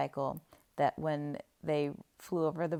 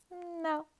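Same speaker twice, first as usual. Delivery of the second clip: quick high denial; 12 semitones higher, 4 dB quieter than usual. Retold or imitated. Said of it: "And she's like".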